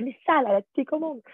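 mock-annoyed insistence; retold exasperation. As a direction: imitate yourself getting a little worked up. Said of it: "on s'est énervé. Je lui dis".

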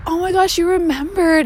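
thrilled regular, high voice; delighted. Retold or imitated. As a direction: gush that she remembered. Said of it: "I was like".

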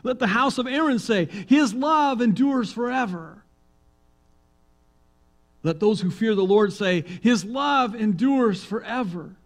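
high, loud temple priest calling; priest's call. Acted. Leading responsive psalm.

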